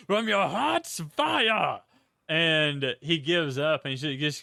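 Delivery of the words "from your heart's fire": retold yell. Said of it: "he just keeps yelling".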